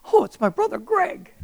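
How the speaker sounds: high-pitched, excited exclamation; surprised delight. Retold or imitated. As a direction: exclaim as if an unexpected call just came. Acts out surprise at brother's call.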